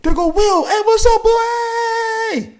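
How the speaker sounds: very high, loud, delighted; light up seeing a friend; high joyful shout with a laugh; excited greeting. Retold or imitated. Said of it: "you go, you just light up".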